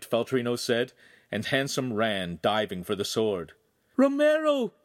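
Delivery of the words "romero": loud, very high-pitched cry; frightened captive's piercing call. A narrator's reading. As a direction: cry his name out loud and high in alarm. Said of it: "Giulietta cried".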